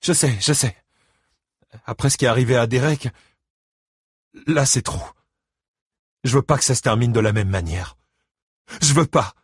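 high, grieving plea; voice breaking with sadness. A narrator's reading. Christine tells her ex-husband it's too much, voice breaking. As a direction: let sadness crack the words. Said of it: "La tristesse lui brisait la voix".

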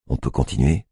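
ordinary delivery, nothing marked

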